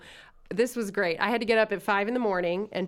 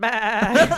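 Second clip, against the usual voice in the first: laughing goat bleat; 6 dB louder than usual. Retold or imitated. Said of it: "and I'm like".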